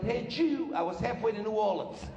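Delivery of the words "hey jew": mild, higher mock yell; retold yell. Heckler to comic on stage, a mild mock yell. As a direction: call it out as an imitated heckle. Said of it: "the guy yelled".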